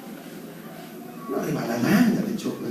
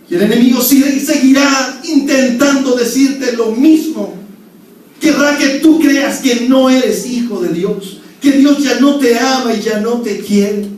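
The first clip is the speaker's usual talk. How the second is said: loud, higher, slow pulpit preaching; preaching at full force; 9 semitones higher, 8 dB louder than usual. Acted. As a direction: drive it home to the congregation. (predicación a todo pulmón)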